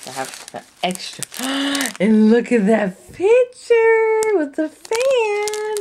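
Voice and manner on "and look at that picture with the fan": high and loud with delight; a slower, gushing call to look; excited. Real, not acted. Gasp, excited unboxing.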